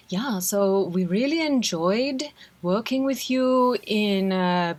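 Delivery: put-on light German accent, slow; a hint of Andre's German accent. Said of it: "let me do it in Andre's accent".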